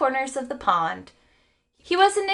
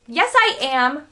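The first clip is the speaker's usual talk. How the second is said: a touch of indignant anger, a bit louder; angrily; 4 semitones higher, 6 dB louder than usual. A narrator's reading. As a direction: protest with mild heat. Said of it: "replied Stuart angrily".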